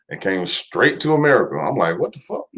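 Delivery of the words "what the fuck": very high, soft incredulous question; retold disbelief. Streamer reacts to the virus reaching America, in high-pitched disbelief. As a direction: squeak out a baffled reaction under the voice.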